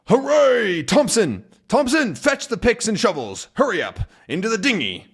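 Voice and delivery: high, hurried commands; cheering captain barking orders; excited orders. Acted. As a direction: hurry the detectives along. Haddock orders happily.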